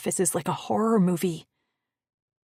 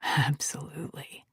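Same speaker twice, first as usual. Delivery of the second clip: whispering; 5 semitones lower, 3 dB quieter than usual. Acted. Tender reply.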